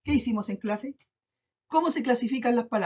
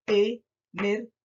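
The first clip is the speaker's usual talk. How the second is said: "Energía" slowly as e, ner, gí, a; syllable by syllable; slower than usual. Shown as a demonstration. Teacher sounding out the word for pupils, "Energía" in slow beats. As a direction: separate each syllable of "Energía". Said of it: "Se carga en la palabra en la I".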